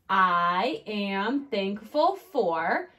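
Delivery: very slow, each word stretched; slow dictation. Shown as a demonstration. Teacher rereading her sentence to kids, slowly. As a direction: read it back word by word.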